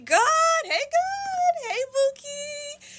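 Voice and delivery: squealed, very high wailing call; high cry to God. Pretend. Vlogger calling to God over a fulfilled prophecy, high wailing. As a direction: cry out high like an overjoyed prayer. Reacting to a prophecy coming true.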